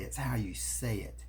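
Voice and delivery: breathy unvoiced whisper for emphasis; hushed punchline whisper. Shown as a demonstration. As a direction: drop to a whisper to land the point. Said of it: "it's not so much what you say".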